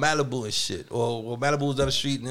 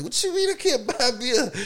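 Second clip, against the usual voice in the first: indignant disbelief; 9 semitones higher than usual. Retold or imitated. Arguing with gas station clerk.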